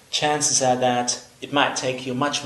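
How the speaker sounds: usual speaking voice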